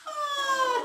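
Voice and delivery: high, loud yawn on oh. Shown as a demonstration. Coach yawns along with the class, loud and high. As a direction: stretch into the yawn. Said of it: "Yawn again".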